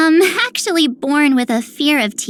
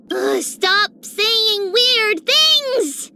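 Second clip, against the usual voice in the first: slow, drawn-out whine of protest; fed-up, flustered plea to stop; exasperated complaint; 3 semitones higher, much slower than usual. Acted. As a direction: groan it out, stretching every word. Flustered at his strange talk.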